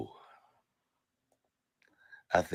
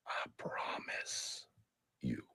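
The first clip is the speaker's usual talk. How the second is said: slow breathy whisper; 11 semitones lower, 23 dB quieter, much slower than usual. Real, not acted. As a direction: drag out a menacing whisper. Whispered repeat of threat.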